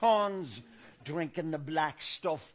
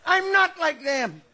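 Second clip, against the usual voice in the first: higher, louder, laughing rant; building mock hysteria; 5 semitones higher, 3 dB louder than usual. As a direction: work yourself into a frenzy.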